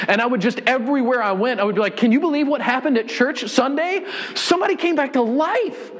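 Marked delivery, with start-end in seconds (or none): 2.0-5.9 much higher, breathless with thrilled news; telling everyone in town a friend came back to life, thrilled and much higher; bursting excitement. Retold or imitated. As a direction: spill the news as if you can't contain it.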